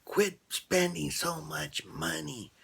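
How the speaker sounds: high, slow, stretched-out scolding; retold yell. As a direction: bark it like the angry father. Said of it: "he yells at his daughter".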